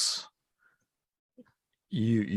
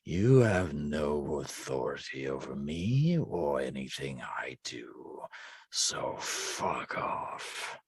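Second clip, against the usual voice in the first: cold low voice; 7 semitones lower than usual. Acted. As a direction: speak low and uncaring. Entity defies the player.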